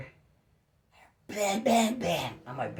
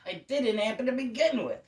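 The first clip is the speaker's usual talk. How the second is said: upset protest; 9 semitones higher, 4 dB louder than usual. Retold or imitated. Retold protest to officer.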